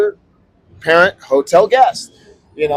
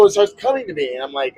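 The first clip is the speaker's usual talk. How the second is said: ordinary delivery, nothing marked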